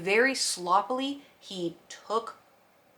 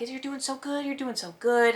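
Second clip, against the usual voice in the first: soothing praise; 6 semitones higher than usual. Retold or imitated. Praising cat during blood draw.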